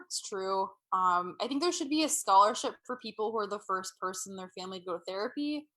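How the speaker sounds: ordinary delivery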